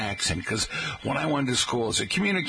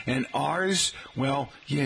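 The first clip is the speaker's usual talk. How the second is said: ordinary talk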